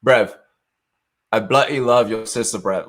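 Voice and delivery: loud put-on British bloke; imitating a drunk Englishman, loud; mock British accent. Prince Harry type raving about the sister, in a mock British accent. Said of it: "He's like".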